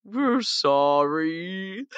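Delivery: very slow, stretched mock sorry; hollow apology. Releasing Cthulhu again, slow hollow mock sorry. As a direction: drag every sound of an empty sorry. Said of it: "it's just".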